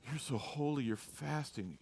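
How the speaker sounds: mock-reverent, softened admiration; hushed awe. Onlooker admiring a fasting Pharisee, hushed awe. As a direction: act out a soft, awed compliment.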